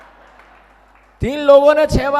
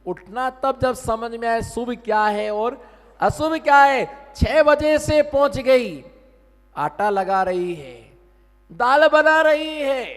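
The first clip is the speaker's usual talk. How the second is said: usual speaking voice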